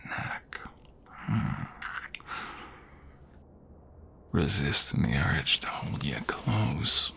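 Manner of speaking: slow, hushed whisper close to the ear; ASMR boyfriend whispering tenderly; intimate whisper. Acted. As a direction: breathe the words softly, almost slowly.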